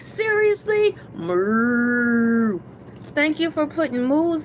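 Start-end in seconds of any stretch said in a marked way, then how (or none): 0.0-1.0 incredulous. Retold or imitated. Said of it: "I'm like". 1.1-2.6 moo. Acted. Moo sound for cows chat.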